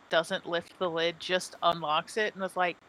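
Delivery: normal talking voice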